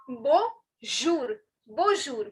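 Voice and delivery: bonjour with zh for the j; soft French bonjour. Shown as a demonstration. Teacher putting je back into bonjour, careful pronunciation. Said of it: "All right, and say je".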